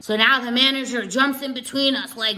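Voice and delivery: normal talking voice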